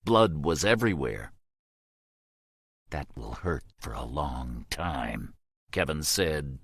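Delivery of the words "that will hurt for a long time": low, slow, hushed menace through clenched teeth. A narrator's reading. Soldier threatening the prisoner through clenched teeth, low and slow. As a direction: grind it out quietly. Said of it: "teeth clenching".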